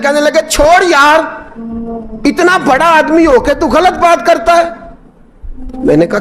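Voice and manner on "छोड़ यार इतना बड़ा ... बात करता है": scolding pilgrim. Retold or imitated. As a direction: scold him like an offended friend. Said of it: "वो हाजी मुझे डांटने लगे". (ऊँची आवाज़ में झिड़कता हाजी)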